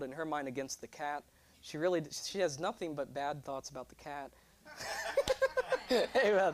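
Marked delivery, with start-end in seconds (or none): 4.7-6.2 high-pitched burst of laughter. Real, not acted. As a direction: laugh at your own joke. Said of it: "laughing at own joke".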